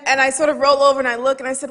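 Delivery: normal talking voice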